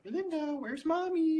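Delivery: high parent voice. Retold or imitated. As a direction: talk to a preschooler like an eager parent. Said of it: "and they're like".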